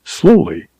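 ordinary delivery, nothing marked

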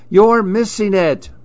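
high, singled-out call to a distracted dad; high-pitched kid's plea; boy calling out. A narrator's reading. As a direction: urge like an impatient child.

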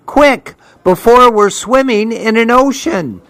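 high, urgent storybook grandma; urgent hurrying. A narrator's reading. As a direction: hurry a child in out of the rain. Grandma urging child out of rain.

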